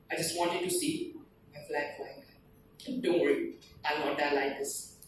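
low, soft, fading reassurance; hushed poem recitation. Acted. Dying soldier reassuring parents, softly recited. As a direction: let the verse sink to a hush.

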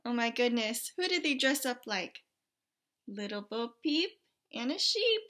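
plain reading voice

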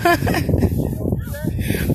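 airy high giggle; breathy laugh. Real, not acted. Vlogger giggles at a teasing bystander.

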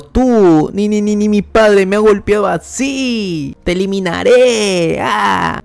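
high, stammering acted rage; unhinged outburst without extra volume; losing control. A narrator's reading. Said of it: "se lanza con todo y pierde el control". (rabia aguda y descontrolada)

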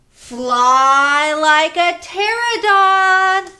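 long sing-song call to fly; stretched flying cue. Shown as a demonstration. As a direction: draw it out like gliding wings. Leading kids' movement game.

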